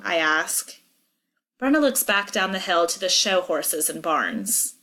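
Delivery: ordinary delivery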